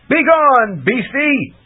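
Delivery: high, loud command; thundering. Acted. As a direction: drive the beast off with a roar. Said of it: "Bink thundered".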